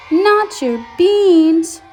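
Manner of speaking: high, loud, very drawn-out mock horror; aghast exclamation. A narrator's reading. Skippy hearing of the stolen frijoles, loudly aghast. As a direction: stretch each word in exaggerated dismay.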